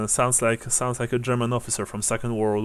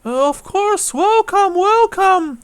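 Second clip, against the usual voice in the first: put-on very high, loud, drawn-out old woman; elderly woman's high-pitched pious exclamation; exclaiming old woman; 6 dB louder, slower than usual. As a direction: cry out a high, slow, fervent welcome. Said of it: "She says as she".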